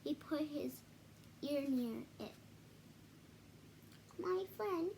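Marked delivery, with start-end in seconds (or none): 4.2-4.9 tiny voice. Acted. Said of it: "came the voice".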